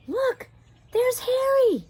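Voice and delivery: put-on high excited boy's shout. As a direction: call out to the dog, loud and high. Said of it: "shouted Tom".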